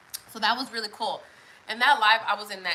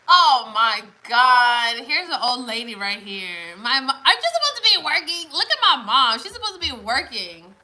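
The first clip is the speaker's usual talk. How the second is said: loud exclaiming; 2 semitones higher, 10 dB louder than usual. Real, not acted. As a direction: call out your mom for the viewers. Teasing her watching mother aloud.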